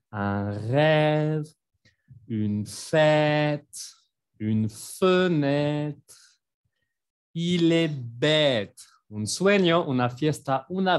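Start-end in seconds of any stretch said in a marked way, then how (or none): 0.1-8.8 "rêve", "fête", "fenêtre", "bête" slowly, with long open è. Shown as a demonstration. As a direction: stretch each ê into a wide "èè". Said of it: "ven, mi boca se abre". ("rêve, fête, fenêtre, bête" lentos, con è abierta)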